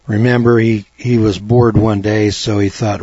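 normal talking voice

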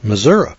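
Missouri said as "mi-ZUR-uh"; local pronunciation. Shown as a demonstration. Yankee echoing the local way, Missouri as "Mizzourah". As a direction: say Missouri with a final "uh". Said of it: "we'd spell it M I S S O U R A".

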